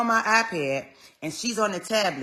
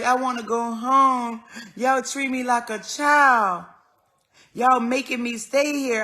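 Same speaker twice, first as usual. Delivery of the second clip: higher, louder and slow, mock complaint; mother's complaint; 4 semitones higher, 5 dB louder than usual. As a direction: voice mom's indignant protest. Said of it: "she was like".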